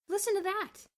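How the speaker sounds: high, loud excited exclaim; excited call. A narrator's reading. Bruno at the window hears chanting, excited. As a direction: call out in surprise.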